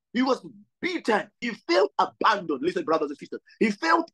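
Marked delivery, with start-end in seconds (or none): none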